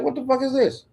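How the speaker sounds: high-pitched retold incredulous question; baffled disbelief. Bobby handed pills by the owner, high incredulous question. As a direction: squeak it out in disbelief.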